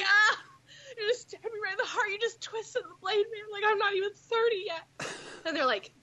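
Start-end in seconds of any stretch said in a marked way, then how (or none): 0.0-4.8 higher, hammed-up anguish, faster; a pretend heartbroken cry at a young man's cougar joke; mock wounded wail. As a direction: ham up being stabbed in the heart. Mock wounded by age remark.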